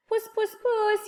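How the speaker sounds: high-pitched cat call. A narrator's reading. Old man hunting for a missing cat, calling high. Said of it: "he called".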